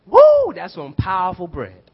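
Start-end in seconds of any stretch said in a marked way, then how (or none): 0.0-0.5 high, loud woo of delight; excited whoop. Real, not acted. Preacher reacting to powerful bread, loud whoop. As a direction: whoop it out with joy.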